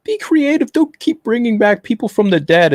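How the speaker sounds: high-pitched mock complaint; mimicking complainers. Host quoting fans against revivals, mimicking complainers. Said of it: "A lot of people are like".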